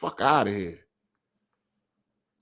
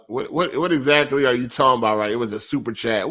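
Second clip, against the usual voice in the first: ordinary delivery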